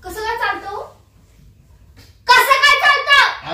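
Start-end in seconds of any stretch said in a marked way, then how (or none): 2.3-3.5 high, loud, drawn-out demand; angry shout. Acted. Jealous wife confronts husband over girl on bike, angry shout. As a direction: shout the question in outrage.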